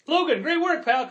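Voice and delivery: put-on high, bright praise for a kid; cheery praise. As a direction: gush over the kid's good work. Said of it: "And then I'm like".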